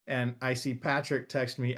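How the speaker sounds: ordinary talk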